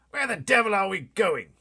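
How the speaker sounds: high, loud, flustered shout; a pompous officer losing his nerve; panicky Air Force chief. Acted. Air Force head yelling at the pilot, panicked and shrill.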